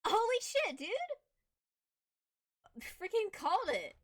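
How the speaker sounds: excited shout. Real, not acted. Guess proven right in game.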